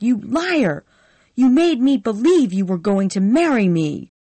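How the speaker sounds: high, loud raging shout; furious. Acted. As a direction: hurl every insult at him. Scarlett rages at Ashley.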